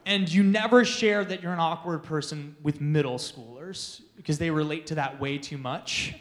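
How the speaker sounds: ordinary delivery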